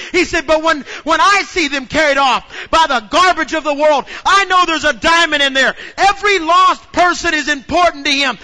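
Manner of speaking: loud, driving preacher's delivery; full-force preaching. Acted. Pastor preaching to his congregation at full force. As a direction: preach it to the rafters.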